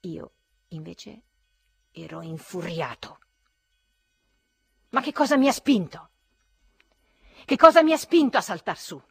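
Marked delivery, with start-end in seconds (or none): 4.9-9.0 loud, higher, through gritted teeth; furious self-reproach. A narrator's reading. Narrator raging at himself in the street, loud through gritted teeth. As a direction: berate yourself, seething. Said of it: "e digrignavo i denti".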